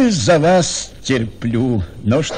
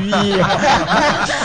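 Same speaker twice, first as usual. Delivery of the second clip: happy laugh; 4 semitones higher than usual. Acted. General laughter at happy ending.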